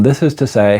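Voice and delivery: normal talking voice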